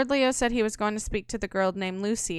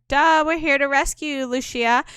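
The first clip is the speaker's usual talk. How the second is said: mocking duh; 4 semitones higher, 6 dB louder than usual. Teasing rescue of Lucia.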